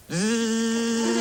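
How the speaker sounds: steady buzzing hum through the teeth; wasp buzz. Acted. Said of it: "it is a wasp".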